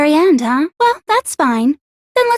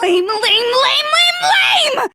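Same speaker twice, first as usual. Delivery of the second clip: high shrieking tantrum, slow on the first; furious shout; 9 semitones higher, slower than usual. Acted. Junko shrieking at the students over her defeat. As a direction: spit each lame higher.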